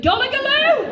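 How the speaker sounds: "Donegaloo!" yelled high and loud in mock disbelief; hammed-up outraged "Donegaloo!"; mocking mispronunciation. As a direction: shriek "Donegaloo!" at the ex's mum's mangled name. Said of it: "Two weeks in Donegaloo".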